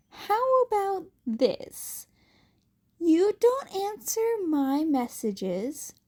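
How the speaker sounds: slow, deliberate accusing tone; pointed interrogation. Acted. Uraraka pressing Deku with evidence. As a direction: lay out the evidence slowly.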